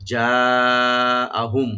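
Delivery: very slow, loud, drawn-out chant; stretched recitation. Shown as a demonstration. Teacher modelling the long vowel for students. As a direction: stretch the madd fully as a model. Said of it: "মধ্যে মুত্তাসিল এক আলিফ টানবেন".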